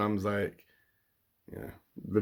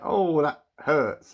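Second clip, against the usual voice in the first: higher and louder, slow and drawn out; mock pained cry; 5 semitones higher, 5 dB louder, slower than usual. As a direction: imitate the boy's theatrical ouch. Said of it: "And he's like".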